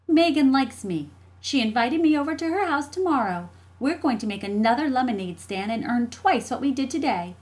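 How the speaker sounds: put-on high, loud child voice, boasting; defiant little sister. Jessie standing tall against her brother, defiant. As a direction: brag back as a proud kid sister.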